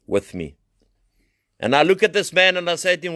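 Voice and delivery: high, loud, impassioned testimony; fiery preaching. Acted. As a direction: cry the story out to the congregation. Preaching voice resumes.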